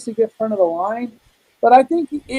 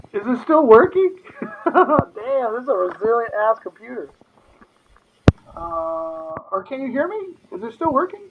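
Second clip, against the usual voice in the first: underwater; 2 semitones higher than usual. Real, not acted. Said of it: "it sounds like you are underwater".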